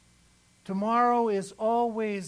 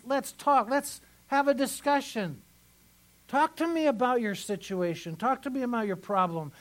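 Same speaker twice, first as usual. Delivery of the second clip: higher, louder preaching of God's plea; preaching God's words; 3 semitones higher, 3 dB louder than usual. Acted. Pastor voicing God inviting the people to talk, high fervent preaching. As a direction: plead with them as God.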